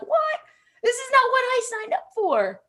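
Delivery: hammed-up disbelief at a coworker; exaggerated high-pitched "what?"; mock indignation. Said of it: "I'd look at them and I'd be like".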